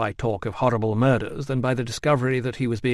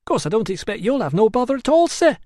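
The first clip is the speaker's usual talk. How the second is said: higher, eager gossip's patter; higher chatty barber voice; 12 semitones higher, 5 dB louder than usual. A narrator's reading. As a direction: prattle on cheerfully to the customer.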